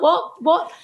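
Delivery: high, puzzled double what; baffled onlookers asking back; bewildered crowd. Retold or imitated. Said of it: "And everyone's like".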